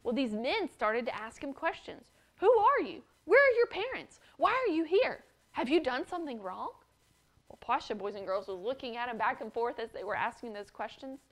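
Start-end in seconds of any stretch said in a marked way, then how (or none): 2.4-6.7 higher, louder interrogation; rough men grilling a frightened orphan; stern demands. Acted. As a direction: fire questions at the boy. Armed men interrogate boy.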